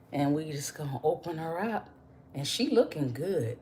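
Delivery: ordinary delivery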